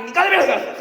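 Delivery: sudden high, loud shout throwing her out; loud outburst at the door; angry husband. Retold or imitated. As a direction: bark it at the wife like a furious husband.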